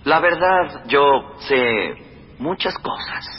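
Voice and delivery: knowing, secretive reply, last words breathed; hint at a secret you keep; mysterious. Acted. Governor hints at secret knowledge.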